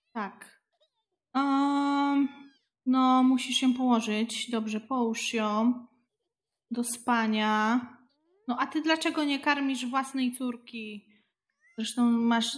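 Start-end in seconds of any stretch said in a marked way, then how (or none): none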